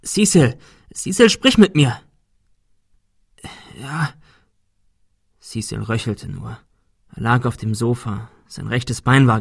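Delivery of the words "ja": faint whisper. Acted. Injured man barely answers.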